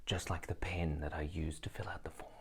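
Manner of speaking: hushed, very soft aside; whispering. Real, not acted. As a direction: drop to a whisper. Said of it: "whispered aside".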